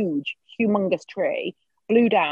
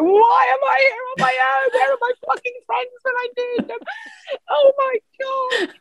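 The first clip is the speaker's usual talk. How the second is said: much higher, tearful wail; imitating crying. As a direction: replay the panicked crying video. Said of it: "did a crying video on Instagram".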